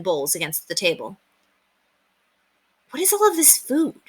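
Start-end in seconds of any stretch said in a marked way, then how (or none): 2.9-4.0 put-on much higher young boy's voice; a boy staring at a feast; boy's amazed question. A narrator's reading. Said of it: "William asked".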